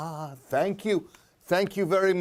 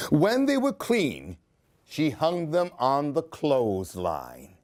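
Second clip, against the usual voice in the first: put-on lively read-aloud for a child; expressive children's story reading; storybook narration; 4 semitones lower than usual. A narrator's reading. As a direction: tell it like a bedtime picture book. Reads picture book aloud.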